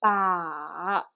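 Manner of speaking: long "ba" falling first, then rising; V-shaped pitch on "ba"; third tone. Shown as a demonstration. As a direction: dip "ba" down and lift it quickly at the end. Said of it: "practice the third tone".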